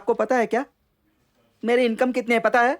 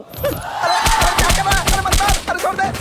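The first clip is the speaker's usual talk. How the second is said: raised, yelping pleas; pained protest; 4 semitones higher than usual. Acted. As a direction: cry out to be let go. Beaten again, begs release.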